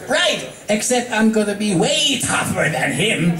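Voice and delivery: high cocky tomboy voice; Rainbow, bragging; boastful Rainbow Dash. Acted. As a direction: brag about it.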